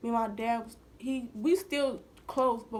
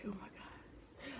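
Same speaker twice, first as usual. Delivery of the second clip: shocked whisper; 3 semitones lower, 18 dB quieter, slower than usual. Retold or imitated. Shocked reaction to dad learning.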